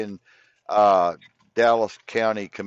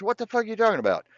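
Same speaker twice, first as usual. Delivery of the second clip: high, fast mock disbelief; retold shocked outburst; 10 semitones higher, much faster than usual. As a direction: fire it off fast and high like he can't believe it. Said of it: "I said".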